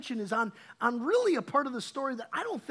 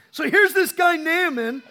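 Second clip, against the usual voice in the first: ordinary talk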